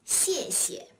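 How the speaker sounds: 谢谢 with the second 谢 short, light and unstressed; neutral-tone second syllable. Shown as a demonstration. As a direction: stress the first 谢 of 谢谢, let the second fall away briefly. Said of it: "second syllable is always pronounced in a neutral tone".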